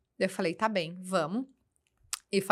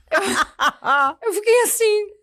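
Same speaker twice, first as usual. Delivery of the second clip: laughing through the words, voice higher; 11 semitones higher, 7 dB louder, much slower than usual. Real, not acted. Mother laughing as she retells her son hanging up. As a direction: giggle while telling it.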